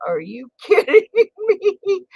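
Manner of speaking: high and slow, laughing through it; can't believe it, laughing; laughing disbelief. Real, not acted. To her viewers, high laughing disbelief. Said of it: "disbelief while laughing".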